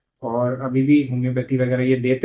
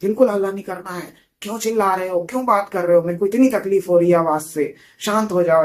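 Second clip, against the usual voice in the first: sudden angry outburst; 7 semitones higher, 6 dB louder than usual. Retold or imitated. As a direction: play the patient yelling for silence, voice raised high. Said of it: "एकदम से बोलती है".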